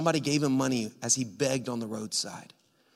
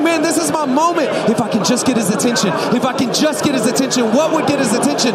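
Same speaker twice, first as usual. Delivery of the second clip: high, loud, desperate urgency; pressing, full-volume acted plea; urgent excitement; 10 semitones higher, 12 dB louder than usual. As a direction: preach it as a man seizing his chance. Beggar urgently seeks Jesus' attention.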